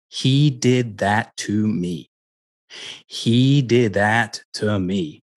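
slow the words He did that to me right down so each one lands; slow, deliberate emphasis. Shown as a demonstration. Coach spelling out the key sentence, slow and weighted. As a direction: lay out He did that to me slowly, weighing every word. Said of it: "this was the sentence to pay attention to".

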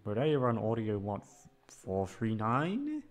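ordinary talk

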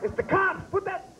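panicked neighbor. Pretend.

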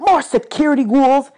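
high, loud moan of grievance; moaning complaint. A narrator's reading. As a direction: moan like an overworked god. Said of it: "he moaned".

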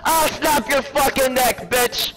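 high, loud screaming trash talk; berserk rage. Real, not acted. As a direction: scream the insults in a full rage. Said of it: "Stop screaming".

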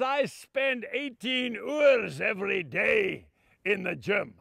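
slow, broad Scottish brogue; drawn-out Scottish father's retort; Scottish accent. Retold or imitated. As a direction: spell it out slowly like a proud Scotsman. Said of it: "that big, strong Scotsman".

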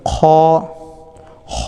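খ said as a separate, careful letter; demonstrated letter sound. Shown as a demonstration. Teacher showing students how to pronounce the letter, careful খ. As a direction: model the letter খ clearly for learners. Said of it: "উচ্চারণ করব আমরা".

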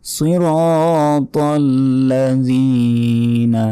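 সিরাতাল লাযিনা drawn out slowly, tongue out on the ذ; tajweed reading. Shown as a demonstration. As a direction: read সিরাতাল লাযিনা with the long vowel held one second. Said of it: "এক second টেনে পড়তে হবে".

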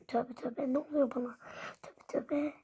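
very soft self-talk; hushed, bewildered aside; muttered aside. Pretend. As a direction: mutter it under your breath to yourself. Muttering to self between shouts.

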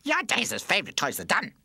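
put-on very high, loud shrieking baby voice with a cackle; gloating baby. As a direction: crow over the beaten toys. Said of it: "laughed Pamper".

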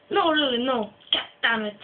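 ordinary delivery

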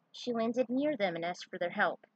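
ordinary delivery, nothing marked

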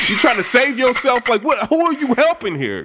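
high, heated, half-shouted accusations; mock angry rant. [name]'s tweet read as a high, half-shouted accusation. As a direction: throw the accusations like a betrayed friend.

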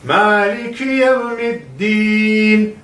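yevmiddin with the final din pressed hard, slow chant. Shown as a demonstration. Teacher stresses din so students don't say tin. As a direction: lean heavily on din in yevmiddin, a clear d not t. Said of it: "üstüne basa basa söylüyorum".

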